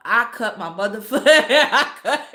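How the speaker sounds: laughter swallowing the words; real helpless laughing confession; breaking into laughter. Real, not acted. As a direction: crack up halfway through the sentence. Cracking up confessing the cut.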